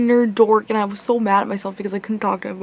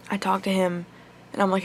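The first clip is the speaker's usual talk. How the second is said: ordinary talk, nothing marked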